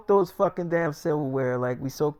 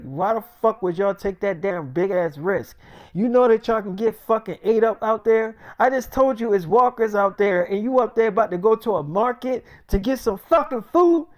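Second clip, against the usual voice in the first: high, loud chewing-out; angry scolding; 6 semitones higher, 7 dB louder than usual. Retold or imitated. Friend yelling at the couple for going out. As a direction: tear into them for the risk.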